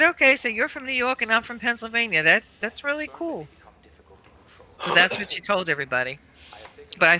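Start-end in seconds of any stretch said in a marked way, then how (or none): 0.0-3.5 mock agreeable. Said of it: "But I said".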